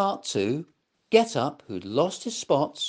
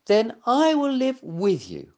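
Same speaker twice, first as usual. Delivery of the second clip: higher, eager small voice; little dog voice; 7 semitones higher than usual. Acted. Little Mutt offering to stay with Get Up. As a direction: offer to stay, keen and loyal. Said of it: "said Little Mutt".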